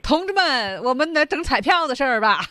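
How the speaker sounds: loud, high hammed-up rally; rally the comrades in mock excitement; mock rallying call. Monica turning everyone to the lottery, mock rallying call.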